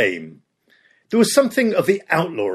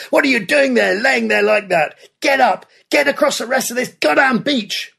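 screaming at men; 9 semitones higher than usual. Acted. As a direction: drive them up off the sand. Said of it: "he screamed at men".

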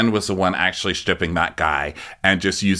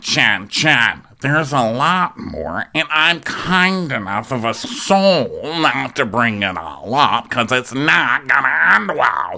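slow, affected mock warning; Meredith; 3 semitones higher, slower than usual. Mimicking Meredith warning Jen there's more, slow and affected. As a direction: draw the words out in a put-on accent.

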